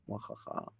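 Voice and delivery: breathy evil villain cackle; whispered mock evil laugh; mustache twirling villain. Villain laugh after ominous line.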